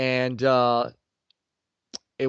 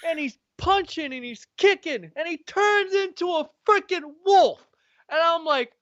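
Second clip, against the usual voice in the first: much higher, building excitement; excited; 14 semitones higher than usual. Real, not acted. Man raving to his friend about the game, excited and high.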